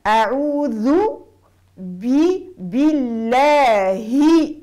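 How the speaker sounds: slow stretched bilahi with full kasra; measured tajweed reading; drawn-out Quran recitation. Shown as a demonstration. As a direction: recite it slowly and clearly. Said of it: "ماهيش بالله".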